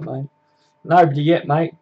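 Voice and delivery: ordinary delivery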